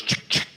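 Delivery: imitated racking shotgun; two crisp mouth-made pump clicks; shotgun pump noise. Comic showing the gun he wanted, pump sound. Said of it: "the one that goes".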